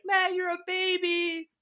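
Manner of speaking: high, drawn-out mock kid voice; taunting kids. Kids laughing at the crying man, high teasing taunt. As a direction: taunt slowly in a put-on high child's voice. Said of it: "The kids are laughing at me".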